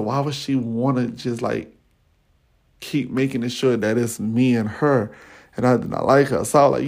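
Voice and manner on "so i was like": normal talking voice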